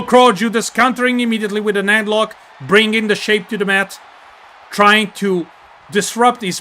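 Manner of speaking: excited wrestling commentary with sudden punched peaks; play-by-play. Acted. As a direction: call every blow as it lands, voice rising with the action.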